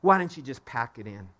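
discouraging inner voice. Retold or imitated. Preacher voicing the inner voice that says quit, low and hushed. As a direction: voice the doubt that whispers give up. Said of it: "Those words".

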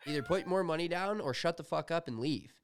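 high-pitched, heated ultimatum; indignant demand, not shouted; retold angry order. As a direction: act out kicking someone off the table. Retold ordering man off the table.

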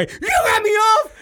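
high, loud, slow hammed-up sob; mock crying accusation. Blaming his son, a slow, high mock-crying wail. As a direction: whine it out like a betrayed crybaby.

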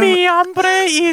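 high, slow mock Mexican accent. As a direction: draw it out in a hammed-up Mexican accent. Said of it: "when you started talking like that".

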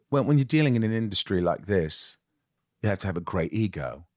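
American attempting an English accent; measured English-accented actor in an interview; Gary Oldman English accent. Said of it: "I always try to think of Gary Oldman's voice when I do an English accent".

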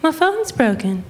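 high-pitched imitation of mom; high mock mom voice; mom impression. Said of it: "she was like".

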